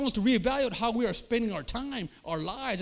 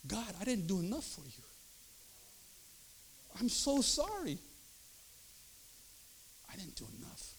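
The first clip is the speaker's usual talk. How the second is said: regretful prayer; 10 dB quieter than usual. Retold or imitated. Retold hospital-bed prayer of regret.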